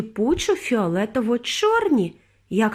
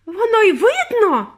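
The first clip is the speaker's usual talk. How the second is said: high, loud, drawn-out outrage; mother's shriek; 11 semitones higher, 6 dB louder than usual. A narrator's reading. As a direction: shriek it back indignantly. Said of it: "верещала пані Борогар".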